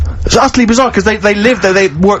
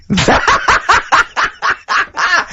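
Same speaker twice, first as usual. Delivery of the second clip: high, helpless burst of laughter; high-pitched real laughing fit. Real, not acted. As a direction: laugh out in a long high peal. Reading out an absurd story.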